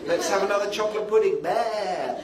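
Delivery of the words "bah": mock sheep "baa"; sheep bleat. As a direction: bleat after the flock. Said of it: "like sheep".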